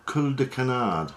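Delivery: a jokey, self-mocking French accent on "cul de canard". Shown as a demonstration. Naming the fly material for viewers in a mock French accent. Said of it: "My fantastic French accent".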